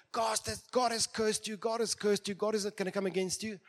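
cursing. Acted. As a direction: hurl the curse like stones. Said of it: "comes up and throws stones".

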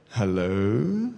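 low, wary questioning hello; mock phone hello. Mimicking someone answering an anonymous phone call, low and wary. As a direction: answer an unknown caller warily. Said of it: "anonymous phone call".

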